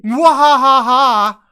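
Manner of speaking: slow booming evil laugh; evil villain cackle. Acted. Machine comes alive, evil laugh.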